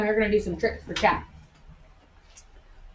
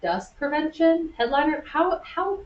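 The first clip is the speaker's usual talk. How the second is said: incredulous; 8 semitones higher than usual. Real, not acted. Disbelief at partner's reason.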